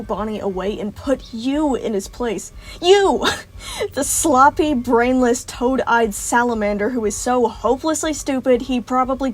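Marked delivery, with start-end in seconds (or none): 2.8-3.3 You! thrown out high and loud; mocking shout at the rival; scornful shout. Villain mocks Monty with scorn. 3.3-3.9 sharp high mocking laugh that falls away; short cruel cackle. Villain laughs at Monty. 3.9-9.3 louder, sneering list of insults; taunting the rival, loud and sneering; loud scornful insults. Acted. Villain insults Monty loudly.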